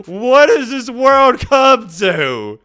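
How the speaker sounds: slow, loud, high, hammed-up disbelief; exaggerated dismay. Vlogger reacting to the boyfriend's text, hammed-up dismay. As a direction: draw it out like the world is ending.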